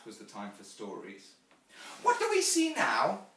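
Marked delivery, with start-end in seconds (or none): 2.0-3.3 put-on high, loud little-girl voice; eager child Jane.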